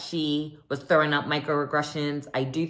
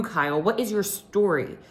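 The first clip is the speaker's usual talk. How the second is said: usual speaking voice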